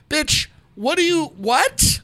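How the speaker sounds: high, disbelieving bitch what; exasperated high-pitched disbelief; incredulous. Real, not acted. Host blasting a caller in disbelief.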